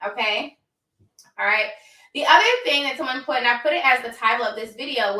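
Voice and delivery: normal talking voice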